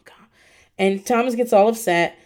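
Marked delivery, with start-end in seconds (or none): 0.0-0.3 whispered, soothing shush; imitating Mama Elsa calming someone. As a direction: hush her down in a whisper. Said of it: "And she's like".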